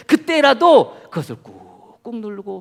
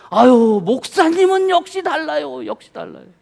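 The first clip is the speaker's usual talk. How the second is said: loud praise for others to hear; 6 semitones higher, 6 dB louder than usual. Retold or imitated. Waiter praising loudly for others.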